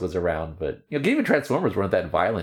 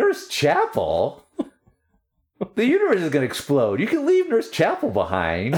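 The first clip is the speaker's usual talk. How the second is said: incredulous; 7 semitones higher than usual. Retold or imitated. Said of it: "and she's like".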